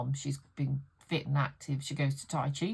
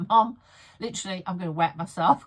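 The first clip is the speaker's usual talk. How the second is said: laughing loudly; 3 semitones higher, 8 dB louder than usual. Retold or imitated. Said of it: "I'm like".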